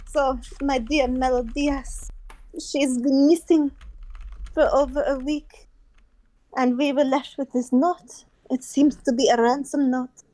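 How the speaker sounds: put-on deliberate French accent with pauses. Opera house head explaining the ransom note, French accent. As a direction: restart the grave tale in a French accent. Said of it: "poor French accent".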